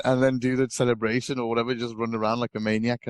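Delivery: ordinary talk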